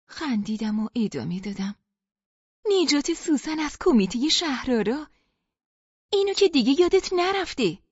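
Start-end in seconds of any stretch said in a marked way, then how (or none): 2.7-7.8 louder and higher, pressing the last memory; insistent laughing reminder. Acted. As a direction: push her to remember, half laughing. (با هیجان و اصرار، بلندتر و زیرتر)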